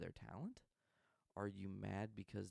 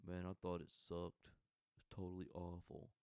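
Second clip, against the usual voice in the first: mock douche. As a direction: sneer it like a know-it-all. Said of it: "the douche and goes".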